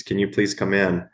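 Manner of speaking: usual speaking voice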